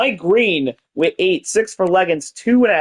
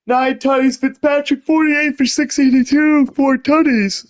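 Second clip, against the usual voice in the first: talking through a yawn; stretched, high yawning words; 9 semitones higher than usual. Real, not acted. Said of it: "Sorry for my yawn".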